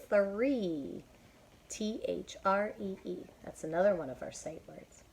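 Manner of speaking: plain reading voice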